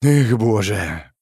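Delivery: slow, weary sigh on the words; exasperated, drawn-out sigh. A narrator's reading. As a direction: breathe it out as a long sigh. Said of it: "вздохнул я".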